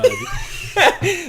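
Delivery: high, slow, laughing proclamation; hammed-up cheerful shout. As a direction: belt it out with a laugh.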